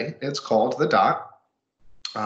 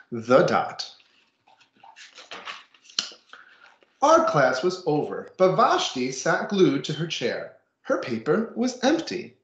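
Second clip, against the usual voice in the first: put-on reading to children; 5 semitones higher, slower than usual. Reads picture book to class.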